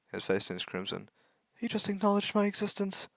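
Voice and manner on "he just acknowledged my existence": much higher, gushing; breathless fangirl thrill; giddy. Acted. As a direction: squeal it like a starstruck fan. Girl thrilled by crush's greeting.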